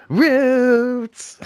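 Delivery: long, high-pitched drawn-out campy holler; loud high impression, vowel stretched out; Paul Lynde. Shouting at a passing actor out of nowhere, Paul Lynde impression. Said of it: "that Paul Lynde voice".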